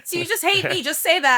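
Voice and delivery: mock hurt. Designer answers a client who hates the style, mock hurt. Joking retort to a harsh critic.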